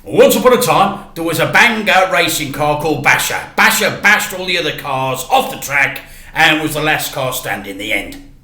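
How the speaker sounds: a dad rattling off a story to be done with it; Dad telling a story. Acted. Dad tells a too-short story.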